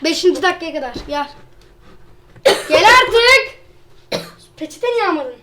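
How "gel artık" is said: loud call, higher. Real, not acted. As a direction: call him over impatiently. Calling friend over impatiently.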